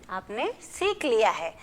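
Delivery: ordinary delivery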